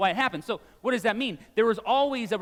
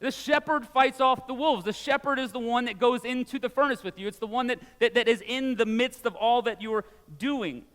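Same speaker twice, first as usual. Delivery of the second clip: rising, louder pastor's proclamation; preaching at full force; 5 semitones higher, 4 dB louder than usual. Acted. Pastor urging the congregation, preaching at full force. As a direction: drive the point home from the pulpit.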